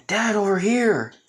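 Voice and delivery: high, loud call across the hall. Acted. Son calling father down the hallway, loud call. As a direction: call your dad over from afar.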